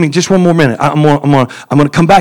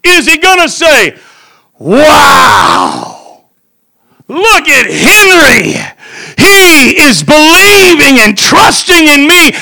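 fiery preaching; 5 semitones higher than usual. Real, not acted. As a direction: lift the church with joy. Preaching at full force.

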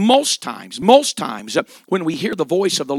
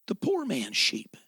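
hushed aside; 5 semitones higher, 5 dB quieter than usual. Acted. Storyteller drops to a hush.

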